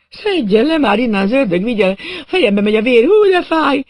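high, loud wail rising at the end; pained demand. A narrator's reading. Man hung upside down demanding to be freed, pained. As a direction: build from urgent plea to howl of pain.

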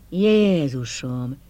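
slow, drawn-out groan of relief; relieved groan. Acted. Bernie learning the glasses are real, a slow groan of relief. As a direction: let the word sag out slowly with relief. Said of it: "nyögte megkönnyebbülve".

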